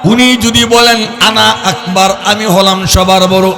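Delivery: ringing sermon chant; intoned Bengali waz delivery; melodic preaching. Real, not acted. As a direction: preach in sustained, tuneful phrases.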